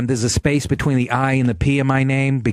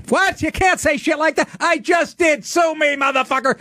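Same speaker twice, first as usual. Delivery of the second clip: high-pitched, exclaimed comeback; mock defiance; 12 semitones higher than usual. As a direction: play indignant, then brazenly defy the critic. Acts out defiant answer to imagined critic.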